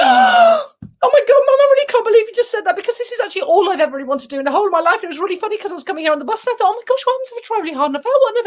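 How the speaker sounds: very high, breakneck excited babble; gushing excited girl. Retold or imitated. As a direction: mimic an overexcited cadet gushing without pause.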